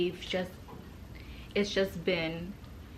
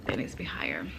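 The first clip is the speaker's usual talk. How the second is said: soft unvoiced aside; whispering; 6 dB quieter than usual. Real, not acted. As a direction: whisper it to yourself. Adjusting camera, whispered aside.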